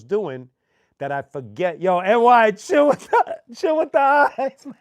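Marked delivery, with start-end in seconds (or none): 1.8-4.7 high, loud "chill with the eyes" heckle; mock tough call-out. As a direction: call him out from the stage, half joking. Mocking a staring audience member.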